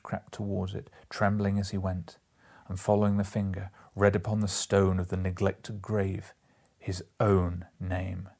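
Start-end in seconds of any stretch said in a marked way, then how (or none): none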